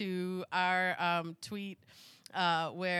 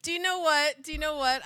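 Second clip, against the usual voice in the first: ordinary talk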